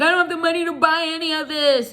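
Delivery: high, loud, wailing mock sob; mock crying girl. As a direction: whine it like a crying girl, hammed-up. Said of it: "boo-hoo-hoo crying".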